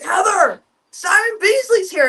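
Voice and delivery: high, excited announcement; excited call. Retold or imitated. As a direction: blurt it out, starstruck. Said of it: "And Mark was like".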